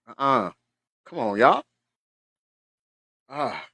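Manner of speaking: usual speaking voice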